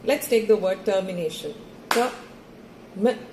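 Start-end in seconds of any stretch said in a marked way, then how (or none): 1.9-3.2 one syllable per clap, long gaps. Shown as a demonstration. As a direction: break Ter-mi-nation into four separate beats. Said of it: "you have to clap".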